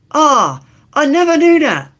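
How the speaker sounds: very high, loud silly voice; high put-on voice. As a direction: squeal it like a cartoon. Catchphrase in a put-on voice.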